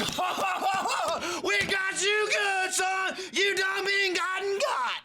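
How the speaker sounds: high, slow laughing hillbilly taunt; high mock hillbilly taunt. Hunters mocking buddy, high laughing voice.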